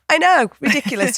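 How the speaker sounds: high, mock-aghast aside; mock disbelief. Real, not acted. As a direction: say it as if it's absurd, laughing at yourself. Self-mocking aside, laughing.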